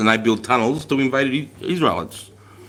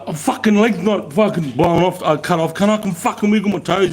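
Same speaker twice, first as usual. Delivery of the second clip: put-on panicked young self; 7 semitones higher than usual. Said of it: "I'm like".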